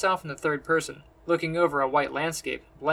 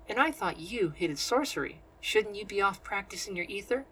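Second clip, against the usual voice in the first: put-on light, higher-pitched young sorceress; teasing woman's voice; 5 semitones higher than usual. A narrator's reading. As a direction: needle him with a teasing question. Teresa voiced with a teasing jab.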